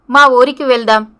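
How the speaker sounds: ordinary delivery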